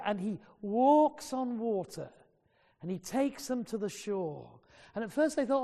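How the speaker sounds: ordinary talk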